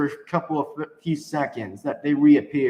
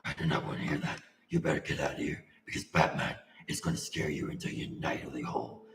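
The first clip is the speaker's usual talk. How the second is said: very deep, hushed growly vigilante voice; low, quiet superhero threat; Batman; 10 dB quieter than usual. Acted. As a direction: drop right down into a soft, menacing rasp. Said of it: "I just love doing that Batman impression".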